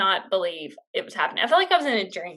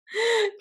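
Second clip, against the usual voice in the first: short squealing laugh, much higher; a quick giddy laugh at herself; high burst of laughter; 12 semitones higher than usual. Real, not acted. Laughing at her own story.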